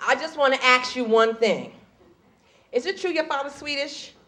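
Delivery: soft, confidential street-slang voice; lightly voiced sister. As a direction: sidle up and ask it under your breath. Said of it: "a sister came up to me".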